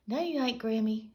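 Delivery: a hint of innocent children; sweetly. A narrator's reading. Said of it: "said the twins sweetly".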